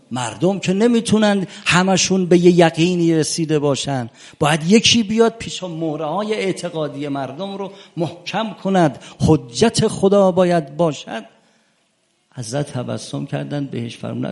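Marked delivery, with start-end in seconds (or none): 0.1-11.2 raised, higher preaching at full force; impassioned preaching. Acted. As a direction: drive the argument home to the congregation. (موعظهٔ پرشور با صدای بلند)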